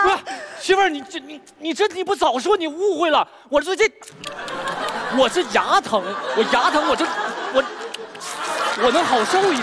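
high, panicked rush of protest; flustered. Acted. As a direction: scramble to explain, flustered. Flustered protest to upset wife.

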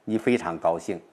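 normal talking voice

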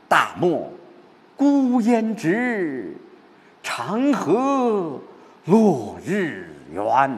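直 and 圆 stretched long and sustained; high, soaring verse that lingers on 直 and 圆; stressed, drawn-out rhymes. Shown as a demonstration. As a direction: recite very slowly, lifting the phrases up to 直 and 圆. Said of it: "拉长、给托起来".

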